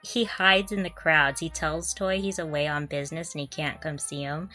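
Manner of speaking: ordinary delivery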